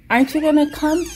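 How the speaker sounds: ordinary delivery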